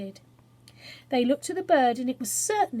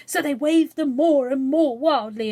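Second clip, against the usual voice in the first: louder and faster as it gets wild; building excitement; 3 semitones higher, 9 dB louder than usual. A narrator's reading. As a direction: let the voice grow with the waving.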